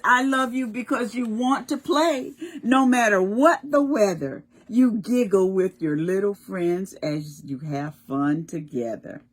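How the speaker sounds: put-on loud, bright read-aloud to children; storybook reading. Teacher reading the book to children, in a lively read-aloud voice. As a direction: read it out like a picture book to little kids.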